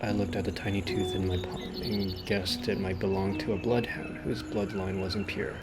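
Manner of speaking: ordinary delivery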